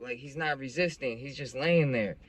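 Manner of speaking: ordinary talk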